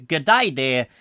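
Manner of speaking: light mock Australian greeting; Australian accent. Reading out Linda's message, in mock Australian accent. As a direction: put on a broad-vowelled Australian. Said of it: "Australian impression".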